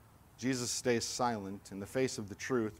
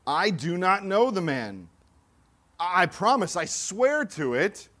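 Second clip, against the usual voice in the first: louder, higher, slower insistent swearing; desperate oath; 7 semitones higher, 7 dB louder than usual. Acted. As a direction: swear it out, pleading to be believed. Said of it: "this time with an oath".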